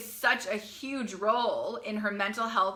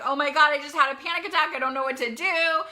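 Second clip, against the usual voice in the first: higher, louder, rushed panic; imitating a frantic college daughter, fast and higher; panicked daughter; 5 semitones higher, 6 dB louder, faster than usual. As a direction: blurt it out like a daughter mid-panic attack on the phone. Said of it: "your daughter is sobbing".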